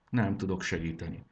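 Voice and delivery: usual speaking voice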